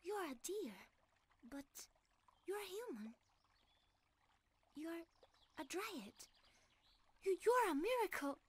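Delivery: playfully mimicked amazement; astonished exclamation. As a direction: imitate his wide-eyed wonder. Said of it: "You exclaimed".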